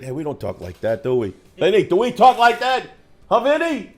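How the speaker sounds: put-on a teasing Brooklyn tough-guy accent, building; mock New York accent. Host mocks New York viewers in a hammed-up New York accent. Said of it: "all of our New York audiences have unsubbed".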